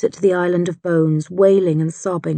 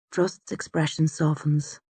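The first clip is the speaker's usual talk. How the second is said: plain reading voice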